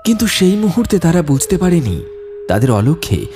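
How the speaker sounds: low, grave storyteller voice; story narration. A narrator's reading. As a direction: narrate the ghost legend ominously. Narrator voice between scenes. (গম্ভীর গল্পকথকের বর্ণনা)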